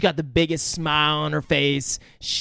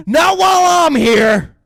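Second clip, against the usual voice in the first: very high, loud shout, slowed at the end; hammed-up screaming; mock furious scream; 13 semitones higher, 9 dB louder, slower than usual. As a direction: scream it like a raging skinhead. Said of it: "He's like".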